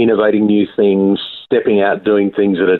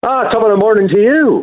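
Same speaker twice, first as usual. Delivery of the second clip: jaunty stage-Irish greeting, pitched up high; playful Irish accent to greet the hosts; 13 semitones higher than usual. Pretend. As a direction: put on a cheery Irish brogue. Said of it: "that's a very good Irish accent".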